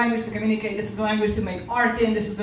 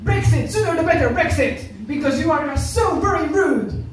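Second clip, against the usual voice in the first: loud, higher-pitched indignant rant; mock outrage; 6 semitones higher, 5 dB louder than usual. Rejected man on the tube berating a European, loud mock outrage. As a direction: imitate an offended man, hammering each complaint. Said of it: "then the guy kind of went".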